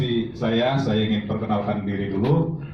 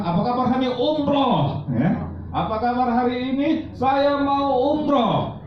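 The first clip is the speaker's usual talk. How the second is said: hyped call-out; 13 semitones higher than usual. Real, not acted. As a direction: pump the audience up. Memimpin yel. (motivator memanggil jawaban hadirin dengan penuh semangat)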